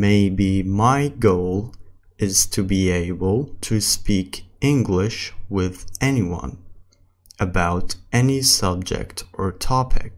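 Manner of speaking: slow English, phrase by phrase; slow practice reading. Shown as a demonstration. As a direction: leave long gaps between phrases for learners. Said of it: "السرعة الثالثة اللي هي الطبيعي".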